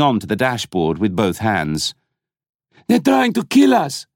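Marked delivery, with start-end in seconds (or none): 2.9-4.1 high panicked yell; terrified scream. A narrator's reading. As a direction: scream in terror. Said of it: "he screamed".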